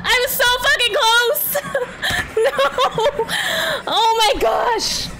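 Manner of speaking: high, whining cry of defeat; anguished wail. Real, not acted. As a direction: wail over a narrow loss. Anguish at losing so close.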